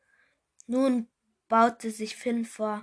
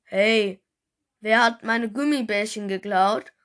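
angry shout; 6 dB louder than usual. Acted. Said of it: "rief er wütend".

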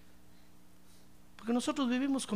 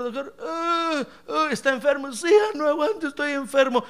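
sick patient; 6 semitones higher, 5 dB louder than usual. Pretend. Sick believer to doctor.

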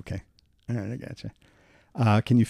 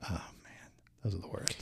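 whispered, much softer sympathy; hushed wince; 16 dB quieter than usual. Real, not acted. Friend wincing at a knockout story, whispered. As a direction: breathe it out in a wince.

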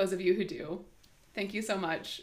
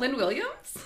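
uptalk; 4 semitones higher, 4 dB louder than usual. Pretend. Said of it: "the whole up podcast in upspeak".

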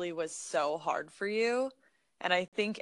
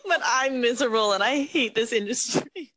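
laughing; 5 semitones higher, 7 dB louder than usual. Real, not acted. Confessing the truth while laughing.

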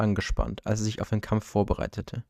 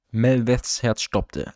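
plain reading voice

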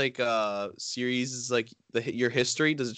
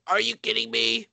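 mock disgust; 8 semitones higher than usual. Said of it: "pretends to be, like, absolutely disgusted".

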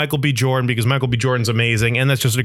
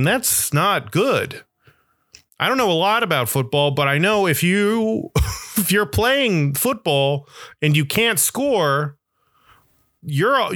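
mock-obvious explaining; 5 semitones higher than usual. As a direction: talk down, draw every word out.

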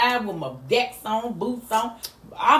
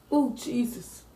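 ordinary delivery

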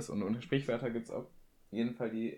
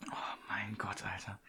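breathless whisper between hiccups; 7 dB quieter than usual. Real, not acted. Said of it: "Schluck auf, killt aufs Übelste".

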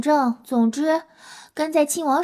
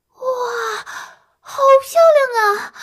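高声惊呼，又尖又响; 被眼前的美景震住，脱口大喊; 11 semitones higher, 5 dB louder than usual. Acted. 看见神兽从天而降. (看到彩虹麒麟，兴奋地高声惊叹)

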